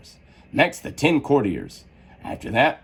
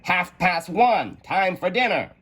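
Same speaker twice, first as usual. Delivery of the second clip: higher, slow Hatter; 9 semitones higher, slower than usual. A narrator's reading. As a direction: give it the Hatter's high, slow tone. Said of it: "Said the Hatter".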